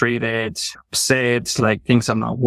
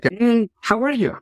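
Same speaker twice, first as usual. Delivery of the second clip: sing-song passing "how are you?", much higher; breezy greeting; 11 semitones higher than usual. Retold or imitated. Colleague greeting in passing at work, mock sing-song. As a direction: toss off "how are you?" lightly while walking past. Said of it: "a colleague at work, something like".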